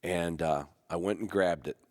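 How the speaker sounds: normal talking voice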